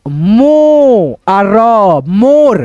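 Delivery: long drawn मो, separate र, then joined मोर; stretched teacher's reading of मोर; sounding out, then blending. Shown as a demonstration. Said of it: "क्या बोलेंगे".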